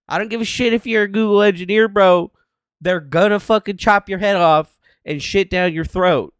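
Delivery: loud, fast, half-joking threat; mock-threatening rant. Real, not acted. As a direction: rattle it off like a mock tough guy.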